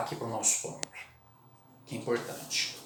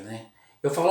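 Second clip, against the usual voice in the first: ordinary delivery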